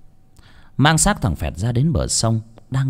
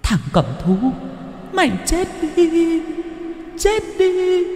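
very high, piercing ghost wail; ghostly scream. A narrator's reading. Lý's ghost screaming as she kills Phẹt. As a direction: shriek the curse like a spirit. Vengeful ghost curses victim.

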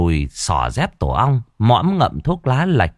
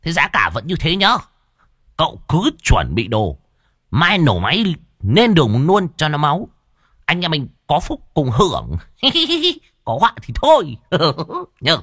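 high-pitched chummy voice with giggles; rally the gang, higher and chuckling; jolly ringleader; 7 semitones higher than usual. Acted. Boss rallies crew, giggling. (giọng cao hề hề rủ rê)

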